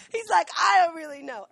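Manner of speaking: laughing through the words. Real, not acted. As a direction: giggle while voicing the confused dog. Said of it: "laughing at confused dog".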